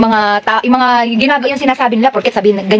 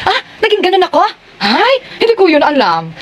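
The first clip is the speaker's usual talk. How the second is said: mock surprised; 9 semitones higher than usual. Retelling her own surprised reaction.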